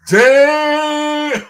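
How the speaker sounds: loud, high yelp of delight; shocked exclamation. Real, not acted. Hearing the Lions loser joke, loud high shout.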